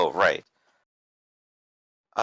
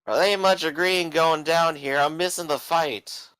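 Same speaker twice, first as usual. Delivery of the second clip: put-on higher, slurring rowdy drunk; 6 semitones higher than usual. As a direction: play a belligerent tavern drunk.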